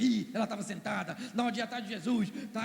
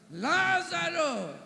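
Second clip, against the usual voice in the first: a slow, drawn-out call, higher and louder; calling Lazarus; 7 semitones higher, 4 dB louder, much slower than usual. Acted. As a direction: call his name across a great distance. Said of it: "uma voz fala assim". (uma voz chamando Lázaro, arrastada e alta)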